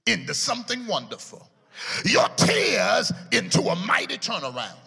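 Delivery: shouted, building sermon cadence; preaching climax. Acted. Preacher shouts the turnaround promise to the congregation, building. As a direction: drive it home to the congregation.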